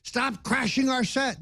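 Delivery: high mock scream of an accusation; imitated screaming. Hosts accusing invited guests of crashing, retold yell.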